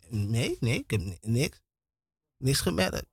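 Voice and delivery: sleepy wife. Retold or imitated. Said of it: "Ze zei".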